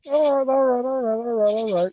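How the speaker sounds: delighted chant of all right; excited cheer. Real, not acted. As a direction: cheer it out, fired up.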